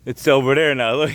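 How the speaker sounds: usual speaking voice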